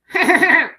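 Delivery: high, loud cackling gull laugh; imitated seagull laugh. Copying the seagull that laughed at her, a high loud cackle. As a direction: mimic the bird's mocking laugh. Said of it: "and it was going".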